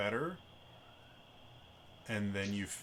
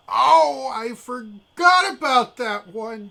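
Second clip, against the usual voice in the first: loud, high-pitched shouted outburst; high, blaring acted exclamation; voice from the stone; 16 dB louder, much slower than usual. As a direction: yell it out, pitched way up. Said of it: "You hear from the stone".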